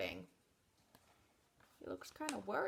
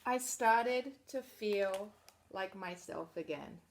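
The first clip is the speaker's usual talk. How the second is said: picture book narration; reading to children; 10 dB louder, slower than usual. Acted. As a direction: read it out to a room of kids.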